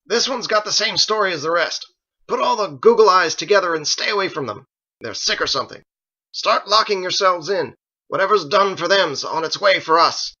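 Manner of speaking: loud, high commanding shout; shouting orders. A narrator's reading. Halkrith warns the gathered village, shouting orders. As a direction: bark orders at the whole village. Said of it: "Halkrith shouted".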